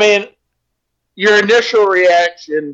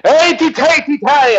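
mock Irish accent; 5 semitones higher than usual. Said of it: "You get to do your funny Irish accent".

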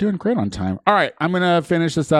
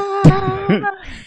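high laugh; 3 dB louder than usual. Real, not acted. Host laughs mid-question.

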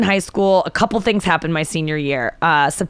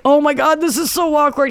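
high-pitched mock-mortified exclamation; awkward cringing; 8 semitones higher than usual. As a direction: squirm through a retold awkward moment. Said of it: "I'm in the backseat awkwardly".

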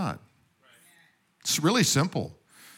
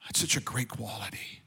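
hushed, awed whisper, much softer; admiring whisper; 7 semitones lower, 13 dB quieter than usual. Retold or imitated. Meeting someone humble, a whispered admiring wow. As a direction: breathe it out in quiet awe. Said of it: "you go like".

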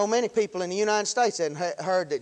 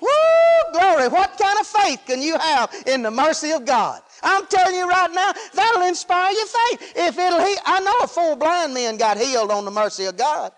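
raised, ringing revival preacher voice; fervent preaching; 8 semitones higher, 4 dB louder than usual. Real, not acted. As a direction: preach it with full conviction. Preaches at full force.

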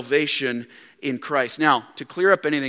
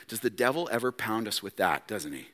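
ordinary talk, nothing marked

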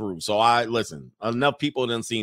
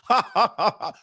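high, forced staccato laugh; mock laugh covering an awkward confession; marital laugh of doom; 7 semitones higher than usual. Spouse admitting empty college fund, fake laugh. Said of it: "The marital laugh of doom".

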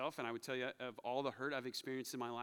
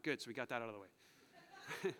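laughing; 4 semitones lower, 5 dB quieter than usual. Real, not acted. Laughing reply to imagined jab.

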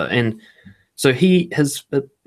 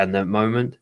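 ordinary delivery

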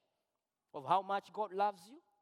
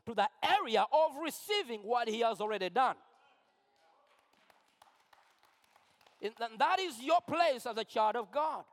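preaching; 4 semitones higher, 7 dB louder than usual. Acted. Preaching at full force.

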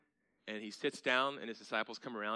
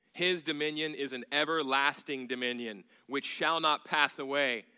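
rising, louder solemn reading of Daniel; scripture reading; 4 dB louder than usual. Acted. Preacher to congregation, building solemn scripture reading. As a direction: proclaim the prophecy with growing force.

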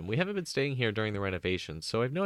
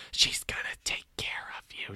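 soft unvoiced whisper; 4 dB quieter than usual. Grandson whispers comfort to his dying grandpa. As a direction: breathe it like a secret.

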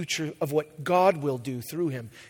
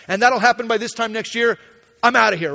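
loud mic-drop exit; 5 semitones higher, 8 dB louder than usual. Acted. God departing emphatically.

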